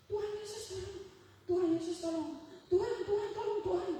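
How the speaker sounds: high, loud repeated cries for help; imitated tearful pleading. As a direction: plead like someone crying out to God. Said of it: "Si Louis sudah nangis terus".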